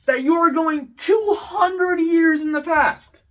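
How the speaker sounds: high, loud exasperated shouting, slowing down; outraged yelling. Real, not acted. Ranting to viewers about a classmate's ignorance, loud outraged yelling. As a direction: shout it in disbelief.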